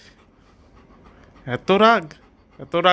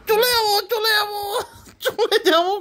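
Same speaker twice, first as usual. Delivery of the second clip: much higher, whiny, laughing through fake sobs; mock crying child; 12 semitones higher than usual. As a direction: imitate the child's tearful threat. Imitating the child's whining. (ন্যাকা কান্নার সুরে বাচ্চাকে নকল করা)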